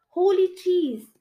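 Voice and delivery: high, slow astonished exclamation; surprised. Acted. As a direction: gasp it out in amazement. Narrator's startled realization.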